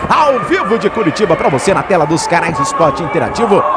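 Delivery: ordinary talk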